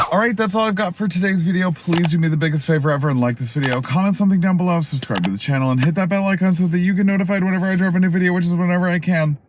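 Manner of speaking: rattled off fast, auctioneer style. Real, not acted. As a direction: rush through the outro like an auctioneer. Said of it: "Did I sound like an auctioneer just then?".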